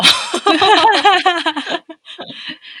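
high, loud burst of laughter. Real, not acted. Laughing at preaching joke.

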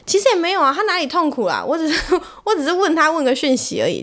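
high, giggling protest; laughing. Real, not acted. 被诅咒后笑着辩解自己只是问个讯息. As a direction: laugh it off while defending yourself.

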